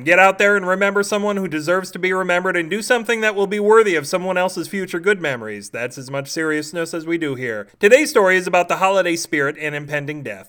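bright, loud, showy host read; podcast host intro. Acted. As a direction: hype up the listeners like a goofy radio host.

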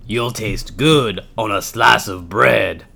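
low monster voice savoring food; hungry gruffalo. Acted. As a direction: gruffalo eyeing its meal.

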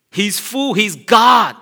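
high, loud shout; proclaiming shout. Real, not acted. Preacher crying out to the congregation, loud shout. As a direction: declare it with full force.

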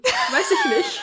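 squeaky laugh through words; laughing squeal. Real, not acted. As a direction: giggle it out, slow.

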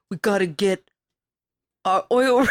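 imitating Bruce Willis. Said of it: "the way Bruce Willis talks".

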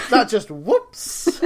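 acting it out. Teasing co-host for reading the slip, acting it out. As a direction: stretch it out like scripted drama. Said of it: "really acting it out".

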